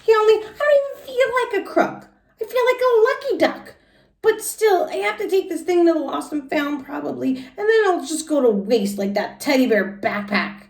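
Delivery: put-on very high, bright kid voice; Junie musing. Junie talking to herself about her pen, very high. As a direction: squeak it out like a pleased little girl.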